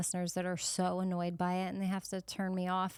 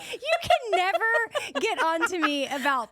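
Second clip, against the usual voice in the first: laughing gloat; 9 semitones higher, 5 dB louder, slower than usual. Real, not acted. Gloating over co-host's pillow.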